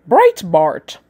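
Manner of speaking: put-on British accent, set apart by a pause. Shown as a demonstration. Host pronouncing the paper's name as Googled, British accent. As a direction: say Breitbart with a mock British accent. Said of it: "I got it pronounced in a British accent".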